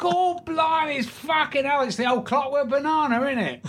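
very high, hammed-up Cockney. Cockney stranger spots a film star in the pub, hammed-up Cockney. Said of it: "this Cockney comes in".

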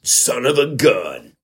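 mock event-ad hype, building to a high finish; hype announcer. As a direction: sell it like an over-the-top arena promo.

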